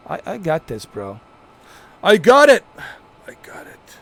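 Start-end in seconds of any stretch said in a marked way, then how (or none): 2.0-2.6 high, shouted assurance; loud insistence. Acted. Insisting he can handle it. 3.3-4.0 hushed, voiceless whisper; very soft whispered aside; whispered assurance. Acted. Repeats assurance under his breath.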